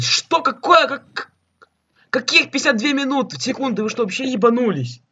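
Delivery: high, loud indignant outburst; furious disbelief at the screen; outraged. Real, not acted. Gamer outraged at timer. (возмущённый выкрик)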